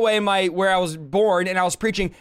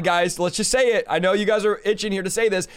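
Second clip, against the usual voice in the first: ordinary talk, nothing marked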